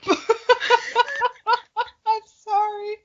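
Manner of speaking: high, breaking with real giggles; words squeezed out through laughter; laughing. Real, not acted. Apologizing to her friend while giggling. Friend cracking up mid-reading.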